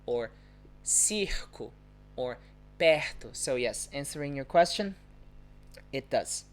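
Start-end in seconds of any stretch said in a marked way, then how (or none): 0.8-1.7 CIRCO with R as a throaty H; throat R inside "circo"; hometown H-like R. Shown as a demonstration. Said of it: "pronunciation in my hometown would be". 2.8-3.3 PERTO with R as a throaty H; throat R inside "perto"; hometown H-like R. Shown as a demonstration. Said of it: "pronunciation in my hometown would be".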